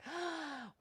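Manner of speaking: sharp acted intake of breath; horrified gasp. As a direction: gasp in mock horror. Acting out a horrible realisation.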